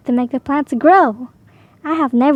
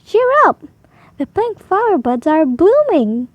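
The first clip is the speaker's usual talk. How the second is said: cheerful Peter; 4 semitones higher than usual. Acted. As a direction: cheer his sad sister up with a bouncy lift. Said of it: "he said".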